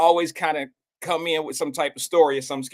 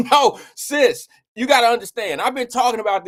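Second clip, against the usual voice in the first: louder and higher, half-laughing; laughing protest; 6 semitones higher, 5 dB louder than usual. Real, not acted. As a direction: push back at the teasing. Protests to a teasing commenter.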